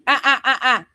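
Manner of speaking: high loud laughing bursts; real laugh burst. Real, not acted. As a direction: break into staccato laughter.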